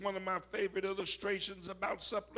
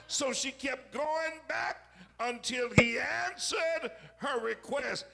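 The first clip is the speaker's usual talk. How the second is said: higher and louder, driving the point home; preaching at full force; 5 semitones higher, 4 dB louder than usual. Real, not acted. Pastor urging the congregation on persistence, preaching loud.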